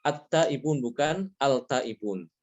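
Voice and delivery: normal talking voice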